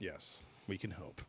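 breathy whispered hope. Real, not acted. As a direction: whisper it, half to yourself. Soft hopeful aside.

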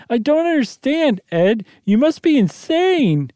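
high, disbelieving wife's voice; frightened wife. Acted. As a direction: push back high and slow, unable to believe him. Janet's voice in the story.

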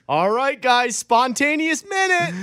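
high, slow mock coach announcing. Fan mocking the head coach, high hammed-up announcing. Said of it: "Mike McCarthy goes".

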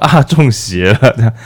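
slow, drawn-out mock alarm; spooked friend. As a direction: gasp it out like you've seen a possession. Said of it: "你就想说".

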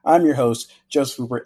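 ordinary talk, nothing marked

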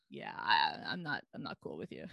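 low mock-serious baby verdict; unimpressed baby. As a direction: drop way down, a cool baby brushing someone off. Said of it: "caught a vibe like".

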